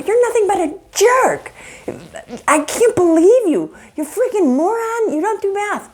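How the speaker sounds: high, heated name-calling; insulting attack. Acted. Spouse berates partner over bounced checks, high and heated. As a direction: tear into your spouse.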